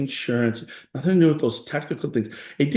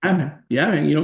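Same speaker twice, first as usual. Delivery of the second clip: ordinary talk, nothing marked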